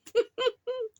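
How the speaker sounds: a quick embarrassed high laugh. Real, not acted. Laughing at her own story.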